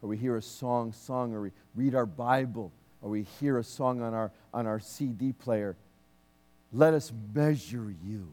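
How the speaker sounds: fervent prayer. Acted. Minister praying aloud over the church, fervent prayer. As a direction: pray over the congregation with fervor.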